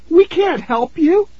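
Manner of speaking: put-on high, scared little girl voice; frightened girl.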